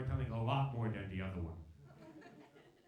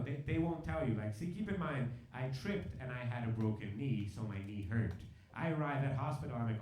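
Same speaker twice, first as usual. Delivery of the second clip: usual speaking voice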